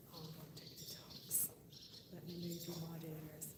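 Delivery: hushed hold on; whisper. Real, not acted. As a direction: whisper an aside to the viewers. Whispers to viewers.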